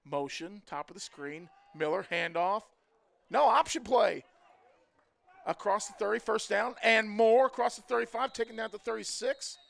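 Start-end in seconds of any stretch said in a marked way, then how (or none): 1.8-9.6 loud rising play call; excited play-by-play. Real, not acted. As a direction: call the run louder and louder. Option play run called live.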